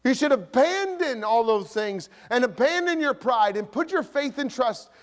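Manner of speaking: impassioned high preacher's cry; fiery sermon delivery; preaching. Acted. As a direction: preach it hard to the congregation. Preaching at full force.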